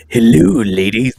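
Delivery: hammed-up smooth-talker greeting, loud; mock ASMR host. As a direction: draw out a jokey ASMR-style hello. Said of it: "Do the read, A S M R style".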